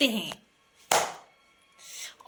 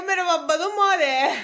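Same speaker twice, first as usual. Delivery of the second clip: quoted comment; 6 semitones higher, 6 dB louder than usual. Retold or imitated. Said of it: "ने कहा".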